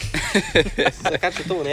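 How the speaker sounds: Canadian accent. Shown as a demonstration. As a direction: stretch out Saskatchewan like a Canadian. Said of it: "That is Canadian".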